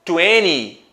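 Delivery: twenty with a silent t, "tweny"; American-style "twenty" without the middle t; American pronunciation. Shown as a demonstration. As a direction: say "twenty" drawn out, dropping the second t. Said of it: "no inglês americano é muito comum o som".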